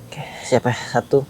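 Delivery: hushed counting under the breath; whispering. Real, not acted. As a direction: whisper the count as you start. Berbisik sebelum mulai hitung. (berbisik pelan)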